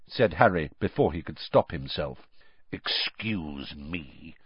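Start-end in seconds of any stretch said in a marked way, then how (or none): 2.7-4.4 low, slow, soft growl. A narrator's reading. Moody growling at Harry's modesty. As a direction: let it rumble low and slow. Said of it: "growled Moody".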